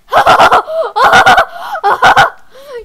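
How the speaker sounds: high, loud burst of laughter. Real, not acted. Player cracking up mid-game, loud laughter.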